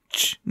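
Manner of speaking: "チュ" as a brief, isolated ch sound; CH sound demo. Shown as a demonstration. Teacher explaining C beside H to learners, "チュ" sounded out alone. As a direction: sound out "チュ" for C next to H. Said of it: "の発音になります".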